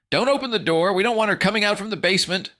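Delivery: playful mock alarm; mock warning. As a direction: pretend to refuse him. Teasing him through door.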